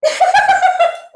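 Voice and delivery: loud, high burst of laughter; laughing. Real, not acted. Co-host laughs during book banter, loud high laughter.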